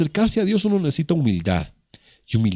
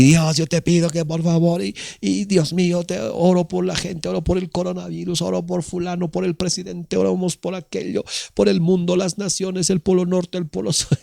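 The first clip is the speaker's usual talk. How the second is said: rattled-off prayer on one higher, monotone pitch; mock machine-gun praying; 5 semitones higher than usual. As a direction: parody someone reeling off prayer requests nonstop. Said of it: "como metralletas". (rezo paródico como metralleta, agudo y monótono)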